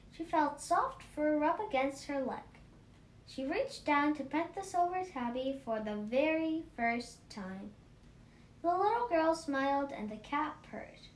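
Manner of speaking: ordinary delivery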